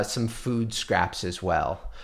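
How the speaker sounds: ordinary delivery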